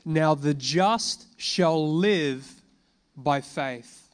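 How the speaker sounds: slow and loud, every word weighted; slow, loud scripture proclamation; proclaimed verse. Acted. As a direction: proclaim it from the pulpit.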